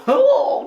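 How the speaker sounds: squeaky high laugh at himself; high burst of laughter. Real, not acted. Laughing at his own finance bro bit, high squeal. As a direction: cracks up at his own joke.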